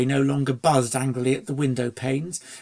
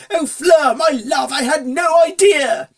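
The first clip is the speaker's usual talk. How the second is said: much higher, loud, overjoyed shouting; shouting to his love, overwhelmed; wild shout; 12 semitones higher, 9 dB louder than usual. Acted. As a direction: cry it out in ecstatic surprise. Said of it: "he yelled wildly".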